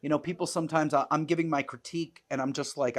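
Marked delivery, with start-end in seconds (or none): none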